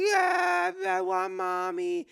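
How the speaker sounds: put-on high, loud sobbing child wail; crying daughter. Dad quoting his daughter crying for mom, in a high loud wail. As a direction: cry out for mommy like a toddler. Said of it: "and then she's like".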